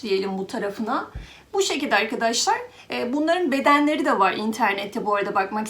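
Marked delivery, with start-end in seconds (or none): none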